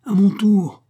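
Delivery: high, loud battle cry; furious retaliation. A narrator's reading. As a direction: cry out to strike back. Wife retaliates in the fight.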